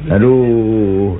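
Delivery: normal talking voice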